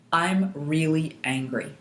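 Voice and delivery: slow, measured statement, word by word; stating the problem. Shown as a demonstration. Coach leading tapping on the eyebrow point, slow deliberate statement. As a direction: state it deliberately for others to echo. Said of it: "we're just gonna state the problem".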